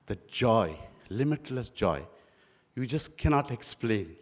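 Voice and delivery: usual speaking voice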